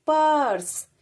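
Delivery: पर्स said with the र half-sounded; पर्स in one syllable, loud and falling; half र demo. Shown as a demonstration. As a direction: squeeze र into पर्स. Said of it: "रा को थोड़ा सा मतलब आधा सा करते हुए पढ़ेंगे".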